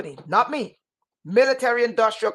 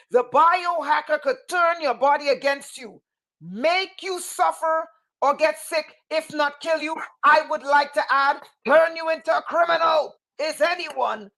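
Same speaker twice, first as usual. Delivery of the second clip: ordinary delivery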